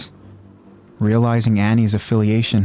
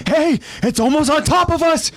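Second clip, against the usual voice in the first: high urgent call; urgent warning. Acted. Bertold warning the others, high and urgent. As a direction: warn them of danger. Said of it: "Bertold warns".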